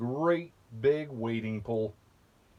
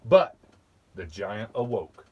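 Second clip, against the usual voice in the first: usual speaking voice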